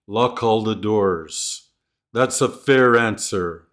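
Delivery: slow, linking each consonant to the next vowel; smooth linking demo; linked words. Shown as a demonstration. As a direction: run Lock into all, fair into answer. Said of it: "When linking sounds".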